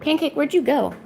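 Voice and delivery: high singsong call to the cat; pet talk. Real, not acted.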